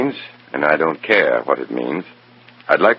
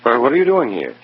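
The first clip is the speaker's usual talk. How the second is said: startled questions; 12 semitones higher than usual. Acted. Surprised to find Revson waiting.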